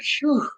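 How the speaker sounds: high, soft breath of relief; relieved phew. Retold or imitated. After a good cry, a high, soft relieved phew. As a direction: let out a light, high sigh of relief. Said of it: "you be like".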